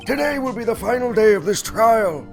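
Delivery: put-on high, creaky old judge voice; old man voice. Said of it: "my old man voice".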